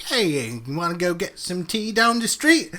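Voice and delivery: slow, odd put-on accent for a polite T-Rex; a lightly voiced dinosaur with an accent, slow; weird T-Rex accent. Imagining a giant T-Rex inviting someone to tea, odd accent. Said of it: "Was that an accent?".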